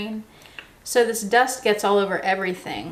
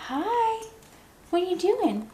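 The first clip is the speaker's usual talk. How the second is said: much higher, sing-song pet voice for the parrot; pet talk; 10 semitones higher than usual. Real, not acted. Cooing at her parrot.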